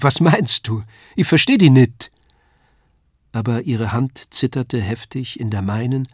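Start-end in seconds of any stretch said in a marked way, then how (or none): 0.0-2.1 louder, higher, caught off guard; startled cry. A narrator's reading. Lisei cries out at Paul's offer, flustered and loud. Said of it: "rief sie".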